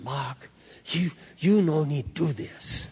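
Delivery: put-on slow, halting broken-English accent; imitated broken English. Tearful neighbour thanking Mark at the door, imitated broken English. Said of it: "tears in her eyes".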